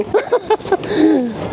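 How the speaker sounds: high nervous giggle on a boat; nervous laugh. Real, not acted. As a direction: laugh it off, scared but thrilled. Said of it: "natatakot ako". (kinakabahang tawa)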